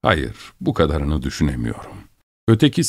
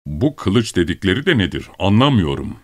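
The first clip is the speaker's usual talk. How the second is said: ordinary delivery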